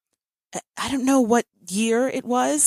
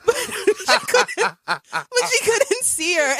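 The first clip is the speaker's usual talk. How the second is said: high, slow, laughing through it; giggling at the memory; 12 semitones higher, slower than usual. Real, not acted. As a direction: crack up while telling it. Laughing retelling the mocking.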